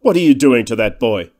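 loud, booming demand; indignant loud challenge; thundering challenge. A narrator's reading. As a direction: thunder it out with authority. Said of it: "would like to have thundered out".

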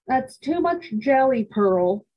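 mother scolding Pearl over jelly, stern. A narrator's reading. A hint of stern mother. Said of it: "said my mother sternly".

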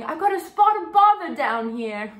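New Zealand-accented understatement, hammed up; mock Kiwi understatement. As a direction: play a New Zealand diver shrugging off danger. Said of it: "Kina calls for help by saying".

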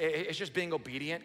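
normal talking voice

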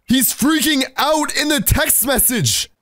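high, drawn-out exclamation; alarmed report. Real, not acted. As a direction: call it out in alarm. Fan rages by text.

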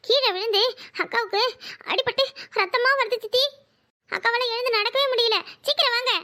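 high, urgent plea for help; distressed, high-pitched child pleading to hurry; panicked child. Acted. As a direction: blurt out news of an accident in alarm. Child reports sister hurt, bleeding.

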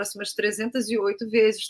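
normal talking voice

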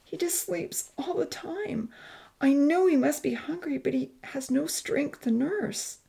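thin thread of voice, pitched high; tearful mother. Acted. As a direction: worry over a child, close to tears. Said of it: "tears welling up in her dark brown eyes".

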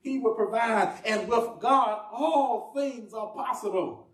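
slow, stretched-out affirmation; deliberate declaration. Shown as a demonstration. Preacher putting theology simply for the congregation, slow and deliberate. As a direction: lay out each word slowly and firmly. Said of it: "I'm just gonna say it like this".

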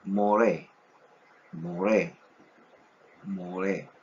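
"moré" with a tapped r, stress on the final e; Hebrew word moré. Shown as a demonstration. Teacher modeling Hebrew moré for students, clearly. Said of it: "La siguiente palabra es".

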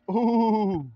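excited ooh; hyped ooh. Real, not acted. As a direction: cheer the shot hard. Reacting to a shot.

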